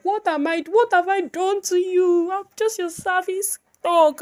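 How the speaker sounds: a high, pleading voice for the put-upon brush; high, hurt squeak of a brush that feels used; whining makeup brush. Acted. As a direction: beg like a mistreated servant. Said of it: "The brush is like".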